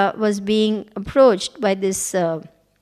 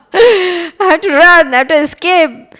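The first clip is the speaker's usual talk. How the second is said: high, loud mock panic, slowed; hammed-up panicked cry; panicked flight; 5 semitones higher, 8 dB louder than usual. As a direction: cry out like a frightened god on the run. Shiva fleeing the demon.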